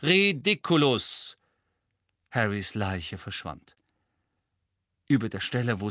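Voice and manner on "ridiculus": slow, clearly articulated spell; loud and clear. A narrator's reading. Lupin casting the spell at the boggart, loud and clear. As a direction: say it firmly and distinctly. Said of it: "sagte sehr laut und deutlich".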